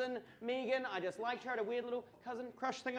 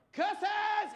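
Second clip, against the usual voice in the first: loud raised-fist yell at the sky; yelled curse; 10 semitones higher, 6 dB louder than usual. Retold or imitated. Dad hits his shin and yells at the moon, loud. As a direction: bellow it like a cartoon villain. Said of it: "raised his fist and just yelled".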